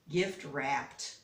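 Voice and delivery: usual speaking voice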